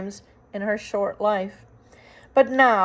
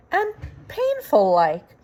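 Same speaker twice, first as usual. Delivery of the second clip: lightly voiced simple girl, higher; a hint of a dull servant girl; Mig's voice; 8 semitones higher than usual. Mig's dialect voice, story figure.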